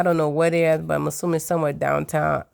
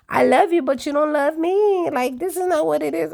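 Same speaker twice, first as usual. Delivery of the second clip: whiny complaining imitation; 8 semitones higher than usual. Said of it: "she's complaining about".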